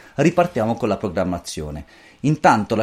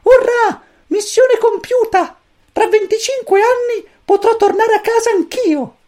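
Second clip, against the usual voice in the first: very high, loud squeaky triumph; cheering chick; 7 dB louder than usual. A narrator's reading. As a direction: cheer like a tiny victorious commander. Pulcino esulta. (pulcino che esulta, acutissimo)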